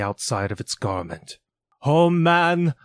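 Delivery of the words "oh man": high, loud cry of Oh, man; exclaiming ghost. Acted. Ghost cries out to Scrooge, high and loud. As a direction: call out to mankind in alarm. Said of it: "exclaimed the ghost".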